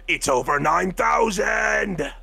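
high, slow, drawn-out shout; mock battle cry. Playing Vegeta's retort, drawn-out shouted catchphrase. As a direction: declaim it like an anime warrior. Imitating Vegeta's famous line.

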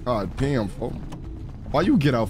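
ordinary talk

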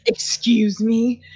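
slow put-on tough voice; faked-up voice. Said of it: "faked up voice".

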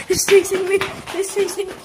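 high, frantic alarm; panicked cry. Acted. Chased by the clown, panicked. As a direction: cry out while being chased.

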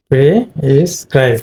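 prescribe slowly broken apart; two-syllable prescribe. Shown as a demonstration. Teacher showing the two-syllable word, prescribe slowly split. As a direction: sound out pri, then scribe. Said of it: "two syllable word".

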